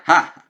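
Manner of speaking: sharp mocking ha, high and loud; mocking laugh. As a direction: bark a scornful laugh. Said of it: "Clea's talking about".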